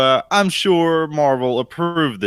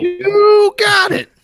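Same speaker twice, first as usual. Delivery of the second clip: high, slow cracking prospector drawl; Prospector Willie voice; 8 dB louder, much slower than usual. Acted. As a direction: squeak it out like an old gold prospector. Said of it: "in your Prospector Willie voice".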